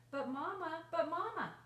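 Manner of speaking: put-on loud, high insistent child voice, rising on the second call; pleading child. A narrator's reading. Child pleads with mother in story.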